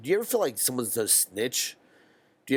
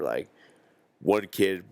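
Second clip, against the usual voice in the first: normal talking voice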